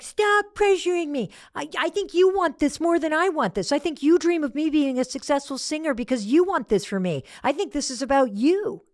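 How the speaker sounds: high-pitched, indignant outburst; exploding daughter. Retold or imitated. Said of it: "exploded at me".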